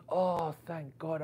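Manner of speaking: imitated relief. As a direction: stretch it out slowly, acting relieved. Retelling her relief at the answer.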